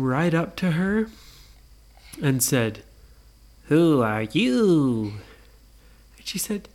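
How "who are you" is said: slow, drawn-out ghostly question; wavering slow ghost voice; spooky ghost. Acted. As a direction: stretch the vowels like a ghost. Ghost's first words to Nora.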